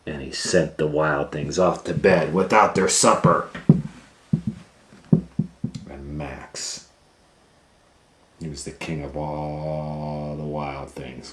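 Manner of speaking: ordinary delivery, nothing marked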